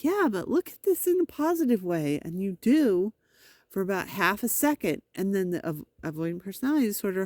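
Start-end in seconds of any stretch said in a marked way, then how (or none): 0.0-2.2 1 mock therapist. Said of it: "the therapist is like".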